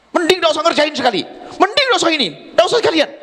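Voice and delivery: mock angry mother. Mother exasperated at child's chores, mock angry. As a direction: throw up your hands at the kid.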